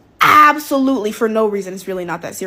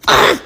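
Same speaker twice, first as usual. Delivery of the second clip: loud, high grunt of frustration; sharp fed-up groan; exasperated groan; 11 semitones higher, 7 dB louder than usual. Real, not acted. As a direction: let out an exasperated "ugh". Exasperated groan ending the rant.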